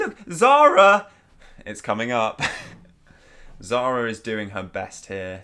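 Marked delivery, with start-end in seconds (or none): 0.0-1.1 loud, high, drawn-out shout; excited call-out. Pretend. Alerting co-host that Zara's scene is coming, excited call-out. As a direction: holler the name, high and slow. Calling out as Zara appears.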